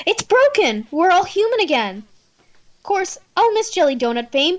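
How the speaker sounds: put-on high, bright-toned cheerful girl character; bubbly friend. Player voices Finley gushing that the curse is broken, high and cheery. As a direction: chatter like an excited friend.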